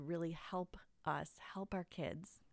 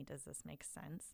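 put-on much softer and slower, a lost child asking; a hint of a worried little kid, softer and slower; small unsure voice; 9 dB quieter than usual. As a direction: let it shrink to a slow, hushed question. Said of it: "our kids are looking to us, to go".

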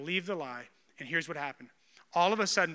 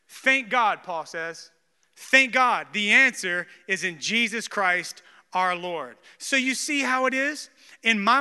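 fervent preaching; 6 dB louder, slower than usual. Acted. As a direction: proclaim it like the climax of a sermon. Preaching rises to proclamation.

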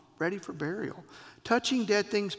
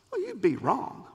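ordinary delivery

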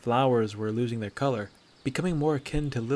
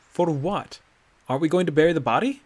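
fast, higher, indignant question; eager protest; 6 semitones higher, 7 dB louder, faster than usual. Acted. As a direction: rush out the protest.

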